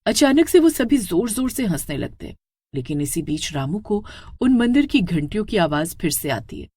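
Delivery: usual speaking voice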